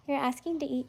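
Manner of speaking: usual speaking voice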